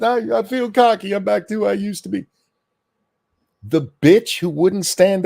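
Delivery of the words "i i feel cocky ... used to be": high, put-on mocking voice of the guest; high mock voice quoting the guest; mocking imitation. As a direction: parrot him like a smug fool. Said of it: "Is that what you were trying to express".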